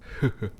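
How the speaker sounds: short high chuckle; a quick pleased laugh; light laugh. Acted. Demon amused by the obedient human pet, light chuckle.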